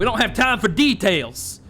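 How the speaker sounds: loud, high, slow emphatic command; urgent, drawn-out bellow; impatient shout. Acted. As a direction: shut down the questions forcefully. Detective cuts off questions, urgent.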